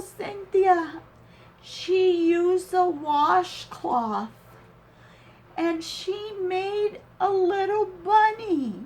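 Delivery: real tearful excitement, high and full; overwhelmed delight. Real, not acted. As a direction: marvel at a gift, voice pitched high. Moved to tears by a gift.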